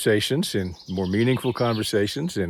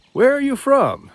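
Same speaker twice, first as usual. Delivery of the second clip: higher, louder mock woman asking; woman's voice; 13 semitones higher, 5 dB louder than usual. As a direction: lightly imitate the curious woman. Retelling a woman on trail.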